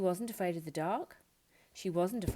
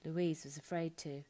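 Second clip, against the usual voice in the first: ordinary delivery, nothing marked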